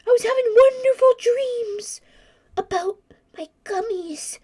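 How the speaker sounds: high-pitched woozy lament; dazed whimpering. Acted. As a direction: whimper about a lost lovely dream. Character wakes dazed after fall.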